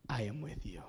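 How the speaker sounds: soft divine reply. Acted. God quietly reassuring Gideon, hushed and low. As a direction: let God's promise drop to near a murmur.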